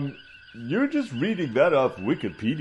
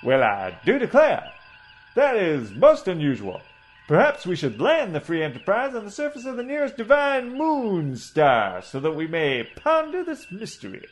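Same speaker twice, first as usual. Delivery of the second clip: blustering Southern gentleman orator, a touch higher; pompous southern doctor; 6 semitones higher than usual. Acted. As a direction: hold forth like a pompous old Southern colonel. Coburn muses grandly.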